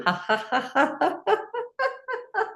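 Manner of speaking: long high-pitched laugh; high rolling laughter; exercise laughter. Shown as a demonstration. Laughter exercise, tea step.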